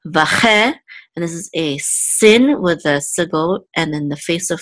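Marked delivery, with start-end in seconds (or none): 0.1-0.7 "vache" with higher pitch on "che"; stress on CHE. Shown as a demonstration. Teacher sounding out the word, "che" of "vache" stressed. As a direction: lift and lean on the "che" in "vache". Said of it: "of this word is on che".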